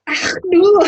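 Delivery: loud, high Glaswegian cry of alarm; attempted Glaswegian. Player running at the dwarves as an impostor, hammed-up Glaswegian. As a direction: cry out in a hammed-up Scottish accent. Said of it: "I'm really sorry to any, uh, Glaswegians".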